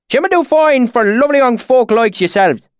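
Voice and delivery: put-on high, loud rustic swineherd accent; thick valley accent. A narrator's reading. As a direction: speak up in broad backwoods dialect. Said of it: "his accent so thick and oily".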